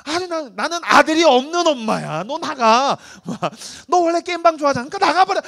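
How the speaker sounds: high, loud scolding; mock angry mom. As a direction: throw the son out in a hammed-up rage. Mom voiced kicking son out. (아들을 내쫓는 엄마, 높고 크게 화내듯)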